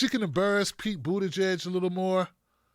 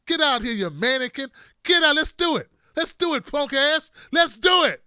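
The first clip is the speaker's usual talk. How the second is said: higher, louder, building to a shouting challenge; old man daring them outside, getting louder and higher; Biden picking a fight; 4 semitones higher, 5 dB louder than usual. Acted. Biden challenging a heckler to fight in the parking lot, shouting. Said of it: "I was just joking when I said I was gonna fight".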